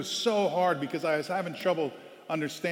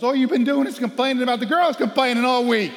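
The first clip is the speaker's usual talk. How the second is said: much higher, louder teasing voice; Rachel scolding; 10 semitones higher, 10 dB louder than usual. Retold or imitated. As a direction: call him out teasingly. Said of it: "She goes".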